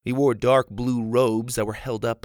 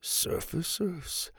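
a father muttering in disbelief; muttered; 7 semitones higher, 7 dB quieter, slower than usual. Acted. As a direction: mutter it under your breath, slowly. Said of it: "he muttered".

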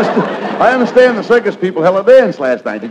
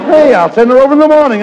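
fast, loud, high burst of eagerness; eager blurt; 6 semitones higher, 7 dB louder, faster than usual. Acted. Abbott leaping at sending his wife to the circus, fast and loud. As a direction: jump at the offer.

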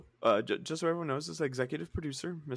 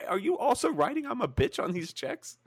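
mock accusation; 5 semitones higher than usual. Accusing Steven.

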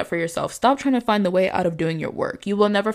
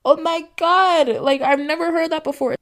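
mock gushing; 10 semitones higher, 5 dB louder than usual. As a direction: ham up being amazed by a compliment. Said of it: "shouldn't be like".

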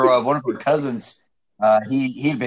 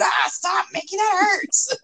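put-on much higher, slow squealing child voice; child begging uncle to stop the rug burn, squealing high; hurt kid pleading; slower than usual. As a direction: whine like a little kid in pain. Hurt kid crying out, imitated.

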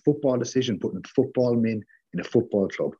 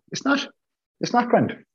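much higher, punch NOT in "It's not grand"; emphatic denial; 14 semitones higher than usual. Real, not acted. Podcaster rejecting Rangnick's part-time plan, emphatic high denial. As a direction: slam down the claim, hitting not hard. Angry rebuttal of Rangnick's claim.

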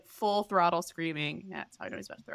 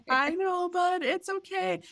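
put-on higher, gently calming the child; a touch of soothing; 11 semitones higher than usual. Mother comforting her crying son, lightly soothing.

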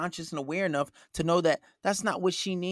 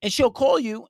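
normal talking voice

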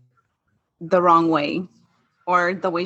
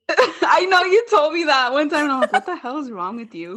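high, breaking up with laughter; laughing retelling; 8 semitones higher than usual. Retold or imitated. Patient retelling a mean moment to sister, laughing. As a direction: laugh through a retold rebuke.

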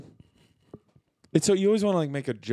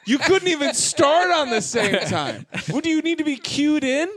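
laughing; 12 semitones higher than usual. Real, not acted. Mocking the co-hosts' timing.

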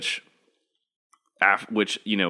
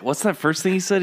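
incredulous; 11 semitones higher, faster than usual. Retold or imitated. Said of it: "I was like".

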